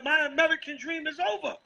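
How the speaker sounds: high-pitched mock complaint; imitating Moab. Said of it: "Moab said".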